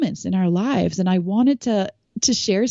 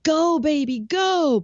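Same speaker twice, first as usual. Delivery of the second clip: high, drawn-out sideline cheer, held back in volume; retold cheering yell; 7 semitones higher, slower than usual. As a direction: imitate moms cheering along the fence. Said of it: "they're yelling".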